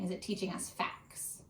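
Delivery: soft whispered aside to the class; whispered question. Acted. Teacher whispering a hint to her students.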